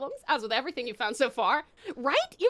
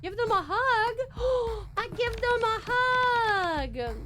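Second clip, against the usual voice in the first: slow tender gasp; 8 semitones higher, much slower than usual. Acted. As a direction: draw it out sweetly. Choosing tender game option.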